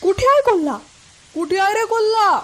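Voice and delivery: high, loud urgent questioning; calling out urgently. Acted. Villagers running to Ramu about the fox, calling out urgently. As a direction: villagers shouting as they search.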